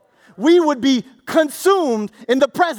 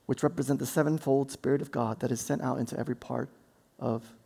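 low, much softer, reverent reading; hushed scripture reading; 12 semitones lower, 11 dB quieter than usual. Acted. Preacher reading Revelation to congregation, hushed. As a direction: draw them in, soft and low.

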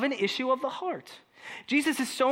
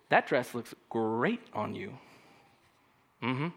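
low, soft, slow fake compliment; raka tone, condescending; 9 semitones lower, 6 dB quieter, slower than usual. Shown as a demonstration. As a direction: say the compliment slowly with quiet contempt. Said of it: "let me say a "Raka" tone".

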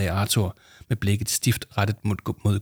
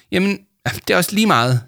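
high, loud, hasty dismissal; flustered brush-off; 9 semitones higher, 6 dB louder than usual. A narrator's reading. Jame let slip her secret job, flustered brush-off. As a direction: wave it away in embarrassment.

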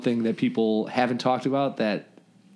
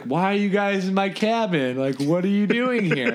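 put-on confused drunk kid; 7 semitones higher than usual. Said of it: "he's like".

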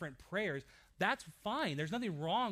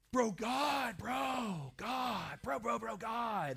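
higher, excited surfer-dude praying; surfer bro prayer; 5 semitones higher, much slower than usual. Retold or imitated. New convert surfer praying excitedly to God. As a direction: pray like a stoked Santa Monica surfer. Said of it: "this surfer Santa Monica Beach language".

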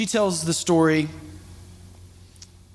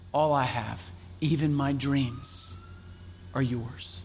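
softer, slow letting go; quiet surrender; 6 semitones lower, 11 dB quieter, slower than usual. Retold or imitated. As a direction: hand it all over in a hush. Woman surrenders her dreams to God.

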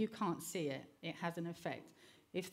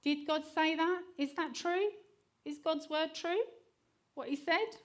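much higher, louder sly questioning; serpent's taunting; 10 semitones higher, 5 dB louder than usual. Retold or imitated. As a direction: tempt her with sly doubt. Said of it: "he went to Eve and he said".

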